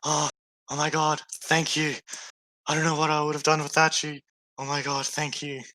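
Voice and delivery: a frightened traveller gasping out her thanks; gasping rescued woman. Acted. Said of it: "is, like, gasping".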